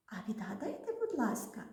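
ordinary talk, nothing marked

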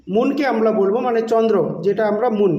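ordinary talk, nothing marked

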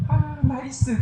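mock approval.

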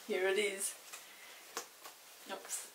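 usual speaking voice

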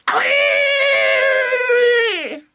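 put-on long, piercing old-woman shriek; high-pitched yell. A narrator's reading. Grandma shocked by the medicine, yelling. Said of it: "Grandma yelled".

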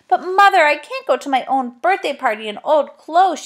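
put-on high, loud protest of an upset child; nearly in tears. A narrator's reading. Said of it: "Bessie was nearly in tears".

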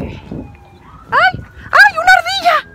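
grito muy agudo de sorpresa; susto. Real, not acted. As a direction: chillar al ver de golpe una ardilla. Se asusta al ver una ardilla. (grito agudo de susto al ver la ardilla)

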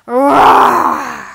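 big roaring shout; loud tiger roar. Shown as a demonstration. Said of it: "Did you roar nice and loud".

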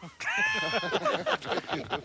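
high-pitched cockroach chatter noises; cockroach language. Acted. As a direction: squeak wordlessly like one cockroach to another. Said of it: "es en el idioma de las cucarachas".

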